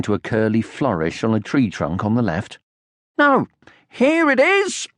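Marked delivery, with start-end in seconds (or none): 3.2-4.9 high excited squeal, stretched out slow; scream. Acted. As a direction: shriek it out in triumph. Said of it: "screamed Sniff".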